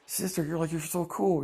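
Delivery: lightly acted fanboy awe; excited little brother. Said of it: "he's all excited to".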